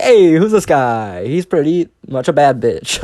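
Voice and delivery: high, loud, drawn-out flirty hype; hammed-up admiring catcall at oneself; playful self-hype. Narrator retelling checking themself out in the mirror, hammed-up hype.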